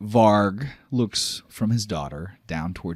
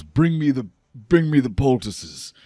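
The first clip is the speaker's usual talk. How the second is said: calling out; 7 semitones higher, 8 dB louder than usual. Acted. Varg calls for help after fight.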